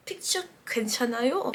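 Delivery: soft, quick rising question; small-voiced, hurried plea; timid request. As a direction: ask meekly, expecting a no. Retold timid request to idol.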